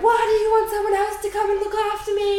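high, loud, exasperated retold protest; indignant outburst. Her to partner offering someone else, indignant.

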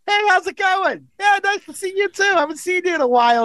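high, fast, cheery mock greeting; fake friendly greeting. Defusing the raging driver with a high, fast, breezy hello. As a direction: greet the angry driver like an old pal. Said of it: "I start saying to the guy".

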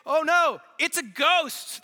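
loud, high cry of alarm; acted panic, pitched high and loud; alarmed outcry. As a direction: cry out as if spotting a ghost, slowing on "ghost". Disciples cry out at ghost.

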